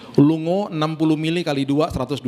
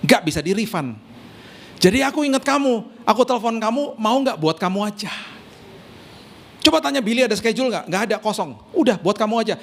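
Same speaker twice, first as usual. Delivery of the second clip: higher chatty woman's voice on a phone call; friend on the phone; 5 semitones higher than usual. Retold or imitated. Friend calling the wife to offer her villa, voiced higher and eager. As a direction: play the apologetic friend calling, pitched up. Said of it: "istri saya ditelepon sama temennya".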